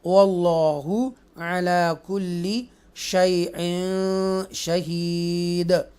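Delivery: slow chanted tajweed reading; measured chanted recitation; Quranic recitation. Shown as a demonstration. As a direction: recite it slowly and melodically. Recites verse in lesson.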